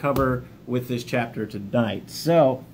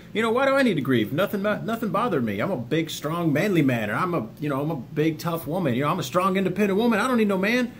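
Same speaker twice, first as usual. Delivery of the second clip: higher, mock-macho bravado, brushing off grief; imitating a dismissive tough guy; 9 semitones higher than usual. Mocking viewers who say they don't need to grieve, in a hammed-up tough voice. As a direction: ham up the I'm-fine denial. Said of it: "sitting there watching this going".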